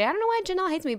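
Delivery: high-pitched mock complaint; mock whining. Co-host imitating Nicole telling everybody, mock whining. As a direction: whine it like a wronged victim. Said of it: "she'd be telling everybody".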